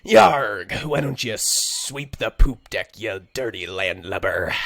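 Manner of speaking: loud, slow, growling pirate captain; slow, booming pirate bark that sounds like a cowboy; pirate impression. Shown as a demonstration. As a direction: roar it at the crew like a cartoon pirate. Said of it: "give us a better pirate impression".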